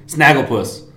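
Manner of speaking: usual speaking voice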